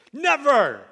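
full youth-group shout; shouted never. Shown as a demonstration. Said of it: "I'd be shouting at that point".

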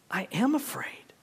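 unvoiced hushed confession; whispered fear. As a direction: breathe it out in awe.

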